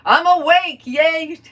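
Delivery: high, loud "yay"; cheerful cheer. Pretend. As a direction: celebrate being awake. Cheerful mock exclamation.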